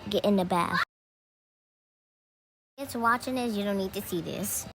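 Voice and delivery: ordinary talk, nothing marked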